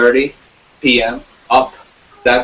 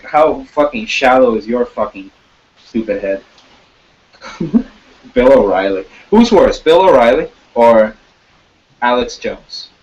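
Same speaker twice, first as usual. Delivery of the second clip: normal talking voice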